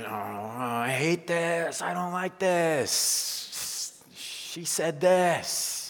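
slow, whiny put-on complaining voice; mock grumbler. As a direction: drag out the complaints like a sulky grumbler. Acting out a grumbling churchgoer.

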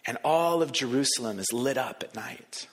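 usual speaking voice

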